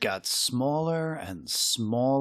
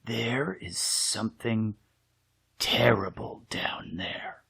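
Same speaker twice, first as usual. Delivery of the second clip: a secret too scary to say aloud; scared whisper; 5 dB quieter than usual. Acted. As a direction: whisper it, softer toward the end. Child warns mother.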